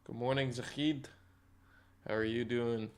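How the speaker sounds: normal talking voice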